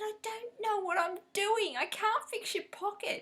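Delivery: high, pleading protest; imitating her own flustered protest; high protesting self. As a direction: squeak out the excuse. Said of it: "I said".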